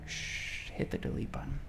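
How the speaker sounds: whispered, hushing. Acted. Pastor hushing the angry urge to reply, whispered. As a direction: hush it down to a whisper. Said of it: "shushing to delete".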